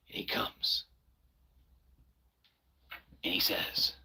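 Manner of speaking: hushed, unvoiced storytelling whisper; spooky whisper. Streamer building suspense about Joey, whispering. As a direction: whisper it like a campfire tale.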